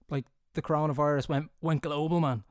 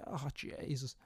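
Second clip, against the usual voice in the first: muttered dread; 13 dB quieter, slower than usual. Retold or imitated. Dreading the blood draw.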